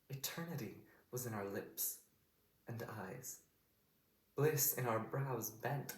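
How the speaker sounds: slow, weighted Shakespearean verse with long pauses; wounded queen reproaching her lover; measured stage delivery, letting each phrase land; Cleopatra's monologue. Shown as a demonstration. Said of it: "I'm gonna go through it once".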